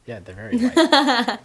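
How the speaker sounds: high laughing, slow. Real, not acted. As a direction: laugh through the words. Laughing joke about whiteness.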